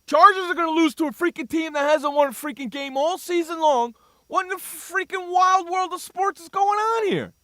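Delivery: retold yelling at TV. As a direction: rant at the TV like a frustrated fan. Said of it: "I'm saying".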